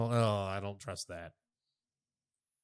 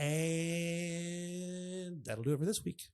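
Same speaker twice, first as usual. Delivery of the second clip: slow sign-off; 6 semitones higher, much slower than usual. Acted. Sign-off, drawn out.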